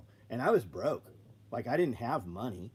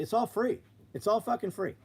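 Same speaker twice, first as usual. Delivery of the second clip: emphatic insistence; 9 semitones higher than usual. Real, not acted. Insisting setup costs nothing.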